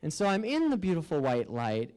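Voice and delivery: ordinary talk, nothing marked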